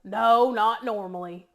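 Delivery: loud, slow country dad comeback; daddy's country voice. Retold or imitated. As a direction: imitate a dry country father, drawn out and loud. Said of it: "And he'd go".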